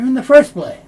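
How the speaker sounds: "first" said without its T, high and loud; dropped T. Shown as a demonstration. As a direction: run "first place" together with no T. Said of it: "the T sound isn't made".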